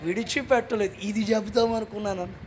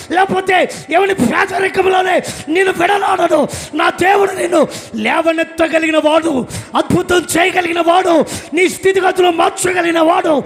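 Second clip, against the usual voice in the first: loud, higher, driving sermon climax; fiery preaching; 7 semitones higher, 8 dB louder than usual. Acted. Preacher rousing the congregation about God's power, shouting fervently. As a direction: hammer each line home to the crowd.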